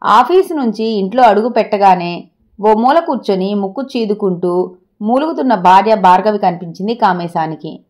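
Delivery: ordinary delivery, nothing marked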